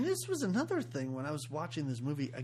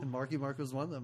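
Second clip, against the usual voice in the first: normal talking voice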